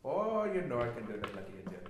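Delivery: put-on Indian accent, stretched opening. Answering co-host's request, hammed-up Indian accent. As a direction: show off a mock Indian accent. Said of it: "Can you do Indian?".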